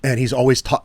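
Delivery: ordinary delivery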